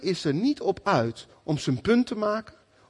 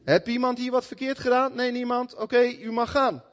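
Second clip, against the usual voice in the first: lightly voiced judge, raised even pitch; 6 semitones higher than usual. Judge dismissing a case in court, lightly acted in a higher voice. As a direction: play a judge briskly waving a case through, pitched up. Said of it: "je komt bij de rechter en hij zegt".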